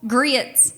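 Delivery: "Grits" drawn out with a Southern glide, "gree-its"; drawled "Grits". Shown as a demonstration. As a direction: stretch "Grits" into two Southern syllables. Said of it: "We have a lot of diphthongs in the South".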